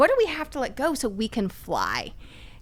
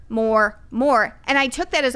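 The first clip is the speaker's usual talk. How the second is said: normal talking voice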